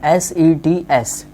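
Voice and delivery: each letter एस ई टी एस slowly and separately; slow letter-by-letter spelling of एस ई टी एस; teacher dictating एस ई टी एस letter by letter. Shown as a demonstration. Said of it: "pronunciation ध्यान से".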